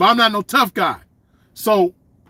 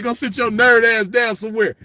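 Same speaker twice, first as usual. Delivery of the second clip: normal talking voice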